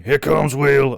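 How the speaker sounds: loud, high-pitched film quote; mock-excited shout, high and loud; Ernie's excited holler. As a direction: holler it out, imitating the movie line. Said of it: "remember the line of Ernie going".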